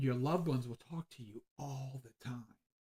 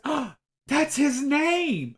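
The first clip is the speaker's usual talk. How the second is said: imitating a gasping, high, loud exclamation; astonished cry; 14 semitones higher, 13 dB louder, much slower than usual. Woman hearing her husband's name, acted-out astonished cry. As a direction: shriek it slowly in amazed recognition. Retold client's astonished reaction.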